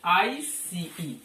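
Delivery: letters spelled out very slowly; slow spelling out. Shown as a demonstration. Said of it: "जैसे हम लिखते हैं आइस". (अक्षर धीरे-धीरे बोलना)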